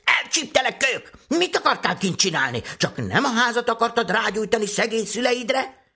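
very high, loud scolding screech; furious high-pitched stepmother voice; yelling stepmother. Acted. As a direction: yell at a child caught red-handed. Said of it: "s így üvöltött".

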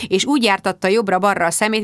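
ordinary delivery, nothing marked

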